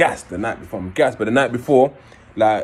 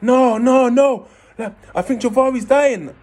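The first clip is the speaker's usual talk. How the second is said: much higher, louder panicked wailing; panicked sob; 13 semitones higher, 4 dB louder, slower than usual. Retold or imitated. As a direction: cry out that a friend is dying. Friend sobbing Javari's dying.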